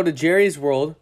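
ordinary talk, nothing marked

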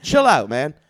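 mock mic warning; calling out. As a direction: shout it down at the crowd. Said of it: "he was like".

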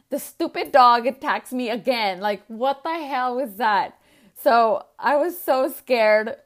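put-on teen retelling the dog attack, loud and worked up; agitated retelling. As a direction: relive the shock of being attacked.

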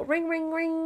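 high sing-song ring ring; phone ring imitation. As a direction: mimic a ringing phone up high.

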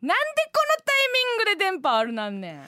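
high, fast comic exasperation; exasperated retort. Real, not acted. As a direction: snap back in mock outrage at the bad signal. Comic retort at bad signal.

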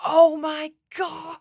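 high, loud and slow, aghast; mock horror. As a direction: draw it out in shocked disbelief. Reacting to a failed podcast recording.

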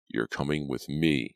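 ordinary delivery

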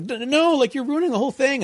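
high, fretful mock protest; flustered. Mocking the flustered bandmate on the bus, high and fretful. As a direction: whine it like a flustered worrier. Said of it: "he gets really flustered and is like".

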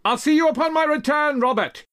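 high, full-volume call over distance; yelling back. Acted. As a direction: yell a farewell back to a friend. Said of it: "but yelled back to Troop".

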